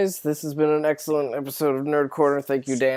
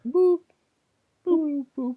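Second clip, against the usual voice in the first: squeaky high boop sounds; high playful boops; 14 semitones higher, slower than usual. Acted. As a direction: pop out cute high boops. Silly sign-off noises.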